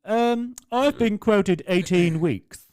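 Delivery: put-on high, slow and loud old dear; old lady voice. Fitter imitating the demanding customer, high and slow. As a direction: drag it out like a fussy pensioner.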